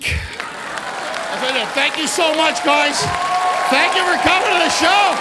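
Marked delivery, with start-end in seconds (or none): 1.7-5.2 high, loud farewell to the crowd; loud show sign-off. Real, not acted. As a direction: shout thanks to the audience. Closing the show.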